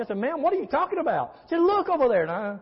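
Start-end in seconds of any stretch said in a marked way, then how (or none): 0.2-1.3 high-pitched, baffled question; mock-bewildered reply, pitched up; retold bafflement. Acts out his baffled reply. 1.6-2.2 high-pitched mock-scolding command; pitched-up insistence, no real shouting; retold angry yelling. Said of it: "I'm getting yelled at".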